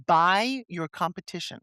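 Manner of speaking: ordinary talk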